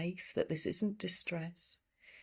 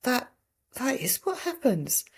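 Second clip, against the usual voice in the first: dawning realization; 6 semitones higher, 3 dB louder than usual. Retold or imitated. Sudden realization at bedside.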